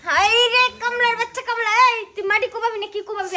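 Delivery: high, loud insults; angry scolding. Acted. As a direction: lay into a useless husband. Wife insults husband in quarrel.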